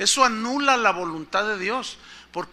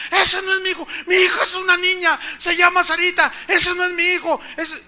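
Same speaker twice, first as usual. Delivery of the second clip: higher, insistent protest; indignant wife; 9 semitones higher, 4 dB louder than usual. Retold or imitated. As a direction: reject the baby outright, pitched up. Wife rejects the baby. (esposa indignada, aguda, rechazando al niño)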